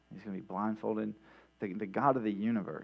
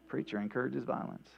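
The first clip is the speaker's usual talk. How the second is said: usual speaking voice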